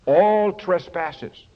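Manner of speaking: All drawn out slowly, falling; slow, weighty All; stress on All. Shown as a demonstration. Preacher repeating All trespasses slowly to congregation. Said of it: "You see the word all".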